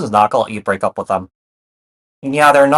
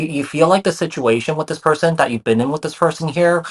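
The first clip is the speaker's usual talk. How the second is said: usual speaking voice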